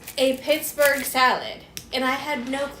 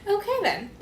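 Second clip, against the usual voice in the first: laughing; 8 semitones higher than usual. Retold or imitated. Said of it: "I'm like".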